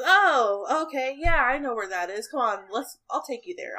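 put-on hearty old farmhand offering to help; lightly voiced friendly old man; Wilfred's voice. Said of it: "he goes".

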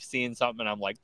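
ordinary delivery

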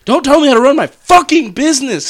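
loud, high-pitched indignant shout; mock-angry outburst. Podcaster told which purchase comes first, mock-angry outburst. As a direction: blow up at your friend in mock outrage. Mock quarrel over buying Wraith.

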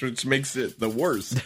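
normal talking voice

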